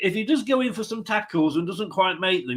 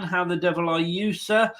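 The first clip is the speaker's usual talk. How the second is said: ordinary talk, nothing marked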